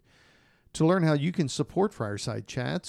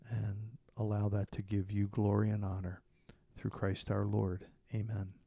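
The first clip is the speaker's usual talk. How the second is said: prayer; 2 semitones lower, 5 dB quieter than usual. Acted. Leads closing prayer.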